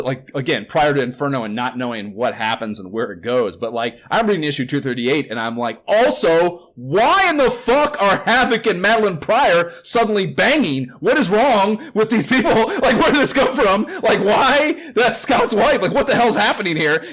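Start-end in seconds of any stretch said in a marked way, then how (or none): 5.9-17.0 high, loud, half-laughing disbelief; incredulous outburst. Retold or imitated. Reader reacting to a sudden comic-book hookup, loudly incredulous. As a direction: re-enact your baffled outrage, pitched way up and loud. Acts out own baffled reaction while reading.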